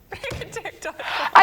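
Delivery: high loud laughing cry; laughing shout. Real, not acted. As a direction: blurt it out through laughter.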